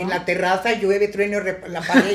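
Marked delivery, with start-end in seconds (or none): 1.7-2.1 laughing. Real, not acted. Laughing while telling.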